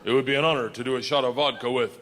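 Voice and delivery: deep-ish Russian conductor; lightly voiced Russian conductor; Russian accent voice.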